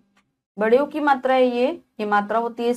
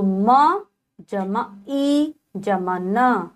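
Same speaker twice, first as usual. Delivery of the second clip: slow spelling; much slower than usual. Shown as a demonstration. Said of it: "teacher spells word parts".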